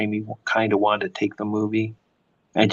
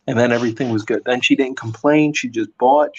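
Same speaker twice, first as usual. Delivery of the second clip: usual speaking voice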